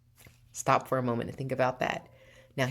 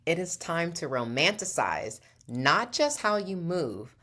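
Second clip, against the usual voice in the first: usual speaking voice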